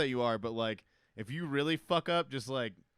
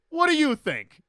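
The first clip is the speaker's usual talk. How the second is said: very high, loud comeback; mock indignant retort; 14 dB louder, slower than usual. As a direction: throw it back as a hammed-up sarcastic yell. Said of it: "the way you did the accent".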